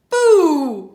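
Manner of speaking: high, very loud mock boo; spring it on the baby; playful burglar boo. Burglar plays boo with baby, mock loud. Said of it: "he says".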